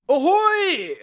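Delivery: high, far-carrying lookout call; shouting from crow's nest. Acted. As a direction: call it down from the mast.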